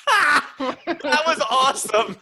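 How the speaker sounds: slow and high, laughing through it; laughing delight. Retold or imitated. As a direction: laugh out the praise, drawn out.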